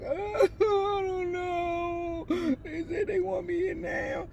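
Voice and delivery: high, sobbing words; upset crying to a friend; crying in distress. Retold or imitated. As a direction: push it out through tears. Crying, telling friend the news.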